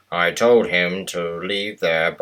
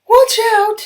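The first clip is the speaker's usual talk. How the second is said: very high, loud bright engine voice; very high cheery diesel voice; Mavis the diesel; 8 dB louder than usual. Acted. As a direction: pipe up high and brisk. Mavis warns.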